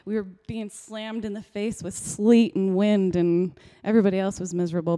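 ordinary talk

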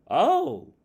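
high, loud, drawn-out "oh"; impressed salesperson. Acted. Salesperson hearing about the company ball, loudly impressed. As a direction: react with big interest, pitch jumping up.

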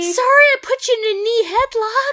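high, quick put-on apology voice; mock apology.

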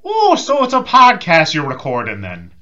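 loud, high, drawn-out Irish lilt; hammed-up Irish accent. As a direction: put on a big, slow Irish brogue. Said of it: "I can just do Irish".

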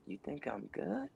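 awed, quiet disbelief at praise; hushed disbelief. Retold or imitated. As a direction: barely dare to ask.

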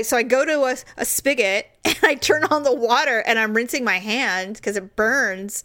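usual speaking voice

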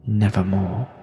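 put-on slow, grave poem reading in an English accent; solemn English-accented recital, with long pauses. Host reading The Raven for December, in an English accent. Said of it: "in an English accent".

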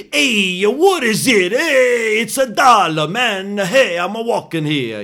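very high, loud, hammed-up Brooklyn caricature. Shown as a demonstration. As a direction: holler it like a Hollywood Brooklynite. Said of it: "a bad Hollywood stereotype accent would be".

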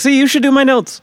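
high-pitched, pleased mock insistence; retold impressed nudge. Host retells praising his wife's sharp catch, retold impressed nudge. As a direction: replay it as a high-voiced told-you-so.

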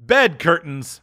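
high, fast and bold; brazen woman. Acted. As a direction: justify the theft, hard. Woman voiced.

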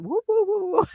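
high hammed-up ghost woo; mock ghost wooing. Spirits pretending to be ghosts, silly high wooing. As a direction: play a silly cartoon ghost.

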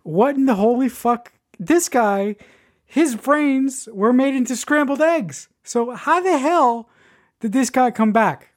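stunned, climbing exclamation; incredulous. Real, not acted. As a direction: react like you can't believe it. Stunned reaction to character's return.

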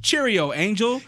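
high, loud English accent; hammy English accent; attempted English accent. Shown as a demonstration. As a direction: call out cheerily in a fake English accent. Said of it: "like, English accent".

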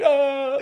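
high, startled "Ah" through a laugh; startled guard's yelp. Retold or imitated. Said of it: "his face is just like".

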